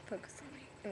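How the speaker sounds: half-joking hushed aside; joking whisper. Pretend. To viewers, ignoring the TV: teasing whisper. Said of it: "No, I'm just kidding".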